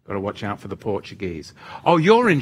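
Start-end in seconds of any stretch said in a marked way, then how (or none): none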